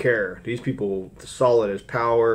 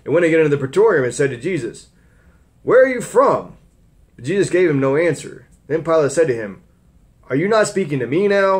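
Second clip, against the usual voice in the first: raised, louder Bible reading voice; scripture reading; 5 semitones higher, 5 dB louder than usual. Acted. Preacher reading Pilate's trial to the church, raised voice. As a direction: read the Gospel out to the congregation.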